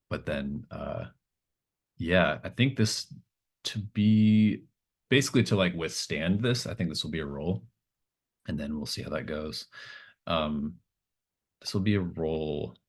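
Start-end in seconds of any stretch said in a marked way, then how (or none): none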